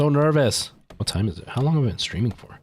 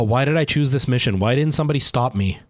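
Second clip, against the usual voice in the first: usual speaking voice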